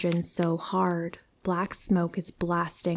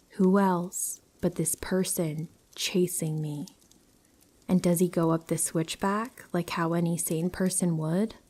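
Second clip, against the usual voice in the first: plain reading voice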